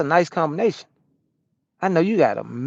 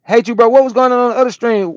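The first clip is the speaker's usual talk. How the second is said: reads the viewer's message out high and loud; loud chat read; 9 semitones higher, 8 dB louder than usual. Acted. Reading a viewer's chat question to the stream, loud and high. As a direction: belt the chat question out, higher.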